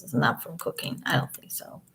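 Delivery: whispered aside. Real, not acted. Doubting Amber's excuse, whispered aside. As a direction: breathe it out like a secret. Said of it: "whispered aside".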